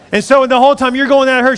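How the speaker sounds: normal talking voice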